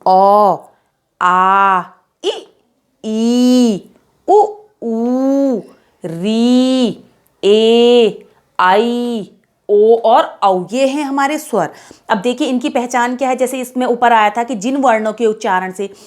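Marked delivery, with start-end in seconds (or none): 0.1-10.6 अ, आ, इ, ई said loud and drawn out, one by one; teacher reciting the vowels aloud. Shown as a demonstration. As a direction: call out each vowel clearly and long for the class. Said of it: "स्वर देखिए कौन कौन से हैं?".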